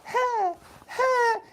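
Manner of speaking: very high karate yells 'ha, ha'; mock kiai shouts. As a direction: yelp like kids in a montage.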